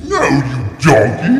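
very high-pitched yelled insult; mock outraged shout. Trying out voices, a mock outraged shout.

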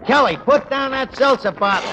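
loud, urgent barked command; shouting order. Acted. Barkeep yelling across the room to stop a fight. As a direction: yell over a bar brawl to stop it.

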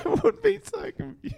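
talking through a laugh. Real, not acted. Host agreeing with co-host, laughing. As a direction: giggle the words out.

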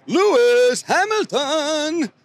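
high, slow and stretched out; excited drawn-out name. Real, not acted. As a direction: let the name soar in disbelief. Surprise of the race.

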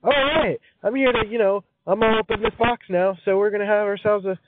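high-pitched, chirpy blue crayon greeting the gang; cheerful new crayon. Acted. New blue crayon hailing the crayon box, chirpy and high. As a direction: voice an upbeat little crayon character, up high. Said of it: "just be like".

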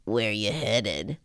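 low, slow creepy driver; creepy older man. Retold or imitated. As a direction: drop deep and slow, sleazily inviting. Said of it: "very creepy dude picks her up".

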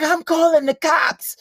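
high, slow, drawn-out yelled threat; mock screaming. Imitating ex threatening to call police, mock screaming. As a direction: yell it high, stretching the words. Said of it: "And she's screaming".

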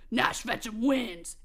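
slow, low-pitched verdict; match announcer. Acted. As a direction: declare the winner slowly and low. Official declares the winner.

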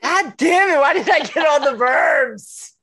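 high, loud cry of protest; comic outraged shout; exasperated outburst. Real, not acted. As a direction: wail the complaint, stretching it out. Mock outrage at getting another verb.

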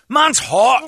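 high, loud overheated whine; mock whine. Friend [name] complaining in the hot car, high whine. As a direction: whimper it like you're roasting. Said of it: "[name] voiced overheating".

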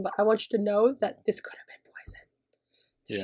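slow, sinking into a whisper; retold hushed threat. Retelling her poison warning to co-host, hushed menace. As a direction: let the warning fade to a soft whisper.

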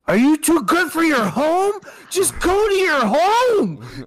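high, loud mock tantrum, last words stretched; movie-quote yell. Podcaster quoting a favorite golf-movie line, mock yelling. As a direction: yell it like a comic meltdown.

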